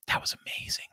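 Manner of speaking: breathy whispered aside. Real, not acted. Whispered aside to the viewers about the event. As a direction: lean in and whisper it.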